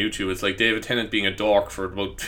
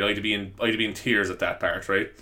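normal talking voice

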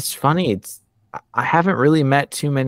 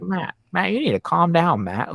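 mild pleading; 7 semitones higher than usual. Retold or imitated. Retold plea to angry show host.

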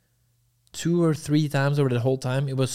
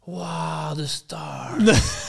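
put-on goofy awestruck girl; 4 semitones higher, much slower than usual. Man imitating her stopping to look up, goofy awestruck voice. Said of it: "She sounds so goofy".

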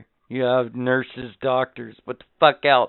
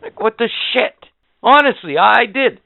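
heated rant; 7 semitones higher, 6 dB louder than usual. Real, not acted. Ranting about delivery room.

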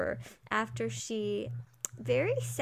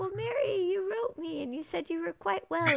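patient Anne; 9 semitones higher than usual. Retold or imitated. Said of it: "And she's like".

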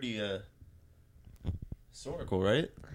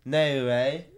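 WAY louder in a hammed-up Scottish accent, NO said as "nay"; bad Scottish accent; 6 dB louder than usual. Co-host trying out how Scots write "no", bad Scottish accent. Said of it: "that was a really bad Scottish accent".

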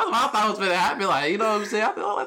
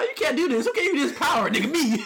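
indignant principal; 7 semitones higher than usual. Retold or imitated. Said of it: "the principal comes by".

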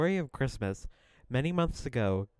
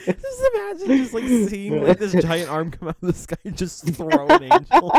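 high excited disbelief; 8 semitones higher, 4 dB louder than usual. Real, not acted. Amazed at thrown angel image.

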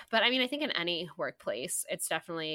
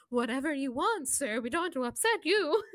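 high, simpering eager-to-please voice; fawning mock; 7 semitones higher than usual. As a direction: grovel in a sugary mock voice. Mocking managers fawning over men.

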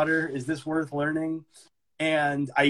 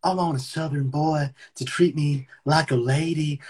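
put-on Southern US accent. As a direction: play up a Southern American accent. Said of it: "the Southern accent is sexy".

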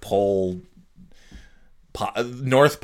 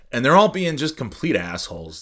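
ordinary talk

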